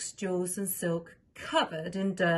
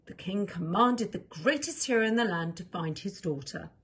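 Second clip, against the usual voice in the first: plain reading voice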